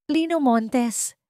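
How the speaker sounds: put-on lordly old lady who is used to giving orders; a hint of a proud old doña, slow; haughty old woman. A narrator's reading. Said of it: "Mapagmataas. Ang boses na sanay mag-utos".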